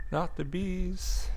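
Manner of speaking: Wicker Man quote. Retold or imitated. Quoting Wicker Man scream.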